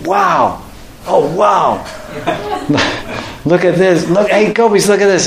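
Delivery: high, giddy squeals with a laugh; thrilled girls pointing out a marvel; excited gopis. Retold or imitated. Gopis calling friends to look, high excited squeal.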